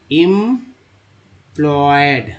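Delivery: Employed very slowly, em-ployed; syllable by syllable. Shown as a demonstration. Teacher starting the word from EM, employed drawn right out. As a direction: hold each part of employed long. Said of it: "ईएम को बोलते हैं इम".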